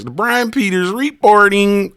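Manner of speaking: high, slow, drawn-out mock reporter; news reporter sign-off. Streamer teasing Brian Peters's name, mock reporter sign-off. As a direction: stretch it like a TV correspondent signing off. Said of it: "sound like you about to report some shit".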